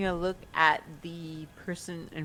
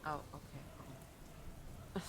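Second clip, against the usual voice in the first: ordinary delivery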